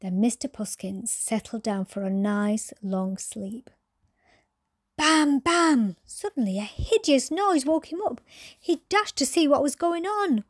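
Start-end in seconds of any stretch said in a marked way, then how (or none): 5.0-6.0 high, loud "bam, bam" like hammered keys; loud piano bangs. Retold or imitated. Sound effect of the kitten pounding the piano, loud and high. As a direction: bang it out like a piano. Piano noise wakes him.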